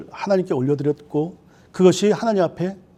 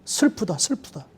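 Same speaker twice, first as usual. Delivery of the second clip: fading lament; 2 semitones higher than usual. Retold or imitated. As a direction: let the grief fade away. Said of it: "시작되죠".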